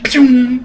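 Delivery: high, loud mock zap sound; toy laser zap. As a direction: fire off a cartoon zap. Sound of sword extending.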